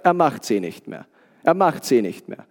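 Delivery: tief und leise angedeuteter Großvater; ruhiger Opa, nachgeahmt. Retold or imitated. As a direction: ruhig wiederholen, mit gesenkter, leiser Stimme. Zitiert ruhigen, gläubigen Opa. (leise und gelassen wie der Opa)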